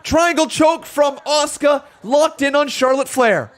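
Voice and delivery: lively ringside match call; live play-by-play. Acted. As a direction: call the action as it happens.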